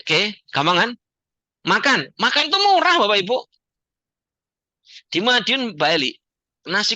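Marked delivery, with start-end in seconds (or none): 2.2-2.9 murah thrown up high and loud, then falling; stress on murah. Acted. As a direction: hit murah hard like a punchline. Seminar speaker emphasising cheap food.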